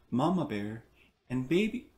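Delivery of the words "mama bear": ordinary delivery, nothing marked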